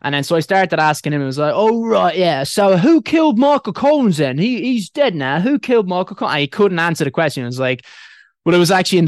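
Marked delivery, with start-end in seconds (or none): 1.6-6.3 put-on English accent, higher and louder; teasing British accent; fake British accent. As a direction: quiz him in a mock English accent. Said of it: "put on a British accent".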